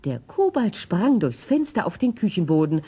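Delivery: ordinary delivery, nothing marked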